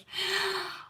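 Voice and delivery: sharp high gasp of mock outrage; high, long indignant gasp; shocked gasp. Real, not acted. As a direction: gasp as if scandalised. Shocked at mum's brush-off.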